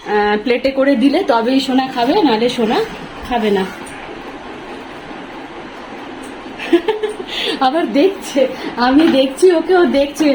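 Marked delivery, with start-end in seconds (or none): 3.7-7.6 soft, mostly breathy giggle rising at the end; quiet laugh that climbs high. Real, not acted. Mother amused at her son in the room, soft giggle.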